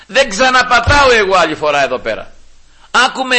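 a churchgoer vowing never to return; offended huff. Pretend. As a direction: storm off insulted, raising the voice. Offended person storms off.

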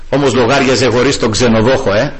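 ordinary talk

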